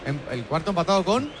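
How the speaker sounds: talking with a pen in the mouth; words round a pen held in teeth. Real, not acted. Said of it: "Sácate el boli de la boca". (hablando con un boli en la boca)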